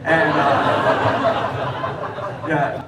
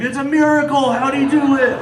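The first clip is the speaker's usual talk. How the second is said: high, loud amazed commentator; ecstatic sports call; 10 semitones higher, 5 dB louder than usual. Retold or imitated. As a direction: shout it like a miracle win. The dog as a stunned commentator.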